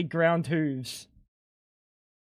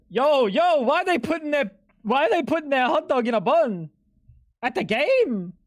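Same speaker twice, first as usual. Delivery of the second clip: incredulous outburst; 7 semitones higher than usual. Acted. Streamer reacting to food pictures.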